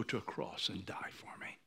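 hushed, tearful whisper; whispered awe at sacrifice. Real, not acted. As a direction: breathe the words softly. Whispered climax.

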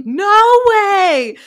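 high, loud mock scream; loud shocked retelling scream. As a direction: scream it in disbelief. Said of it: "she screams it".

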